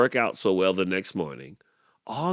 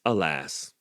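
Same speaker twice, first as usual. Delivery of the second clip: plain reading voice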